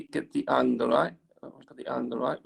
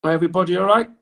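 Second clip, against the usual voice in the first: louder check-in to the whole room; calling out to the class; 4 semitones higher, 10 dB louder, faster than usual. Real, not acted. Teacher calls out to the class while they draw, louder. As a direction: raise the voice to reach every student.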